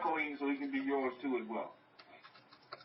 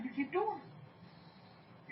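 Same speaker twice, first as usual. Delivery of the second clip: slow, breathy whisper of the same words; 11 semitones higher, 7 dB quieter, much slower than usual. Real, not acted. Preacher urging the congregation to keep going, slow whisper. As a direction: drop to a slow secret whisper. Preacher repeating his instruction.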